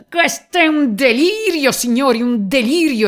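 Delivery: very high, loud, indignant cry; outraged exclamation. Acted. Police chief to the gentlemen, outraged shout. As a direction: explode at the whole room. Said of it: "esclamava il capo della polizia".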